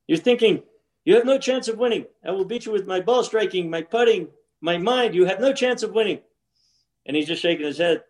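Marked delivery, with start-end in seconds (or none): 0.1-6.2 high-pitched, playful imitation of a boast; mock boastful taunt. Golfer voicing his rival's supposed thoughts, mock boastful.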